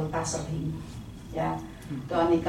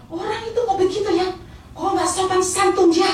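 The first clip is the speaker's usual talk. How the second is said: indignant outburst, pitched very high; imitated angry driver; 14 semitones higher, 5 dB louder than usual. Taxi driver outraged on the passenger's behalf, angry. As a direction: act out a driver flaring up, high and increasingly loud. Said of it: "Supir ini ngamuk".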